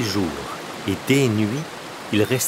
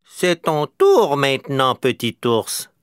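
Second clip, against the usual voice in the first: high, louder call, slow; 7 semitones higher, 5 dB louder, slower than usual. A narrator's reading. Crow calling down to the bear from the rock, loud and high. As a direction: cry it down from the top of the rock. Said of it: "s'écria".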